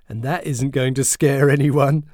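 loud, high teasing voice; boy teases stepbrother about ghosts; mocking taunt. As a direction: taunt him across the table. Joshua taunting Alvin.

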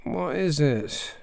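slow, drowsy mumble; boy just woken mumbles a question; sleepy mumble. Acted. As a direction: mumble it half awake.

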